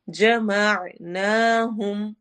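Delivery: very slow, pause on the throat letter; Quran recitation. Shown as a demonstration. Teacher demonstrating ain, slow recitation. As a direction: hold the ain sound. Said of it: "आइन, हरूफ हलकी में से".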